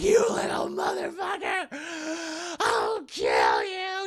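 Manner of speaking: high, strained, drawn-out threat; slow rasped shout of a burned villain; Chucky. Acted. Burned doll chases Andy, high strained threatening cry.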